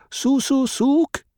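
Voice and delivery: high, ringing street vendor's call; water seller's cry. Acted. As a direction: sing it out like a water seller hawking. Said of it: "he imitated the call with such accuracy".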